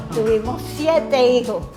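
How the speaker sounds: ordinary talk, nothing marked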